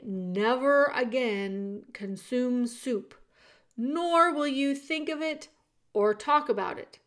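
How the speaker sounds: loud, deliberate royal decree; officious soldier. Acted. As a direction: proclaim it as the king's servant. Soldier proclaims the king's law.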